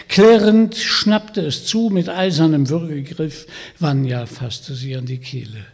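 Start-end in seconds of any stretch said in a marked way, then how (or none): none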